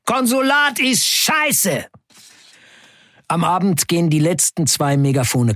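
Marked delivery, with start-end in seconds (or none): 0.1-1.9 high, slow defiant yell; crowd shout. Acted. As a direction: shout it up at the consulate official. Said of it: "Viele von uns rufen".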